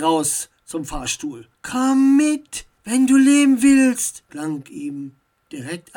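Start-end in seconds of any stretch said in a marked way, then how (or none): 1.6-4.2 loud, raised-pitch urgent order; pressing demand from an unseen stranger; urgent command. A narrator's reading. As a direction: call out a warning, high and forceful. Invisible stranger urgently orders Max along.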